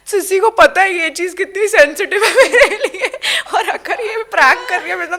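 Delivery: laughing. Retold or imitated. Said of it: "मैंने कहा".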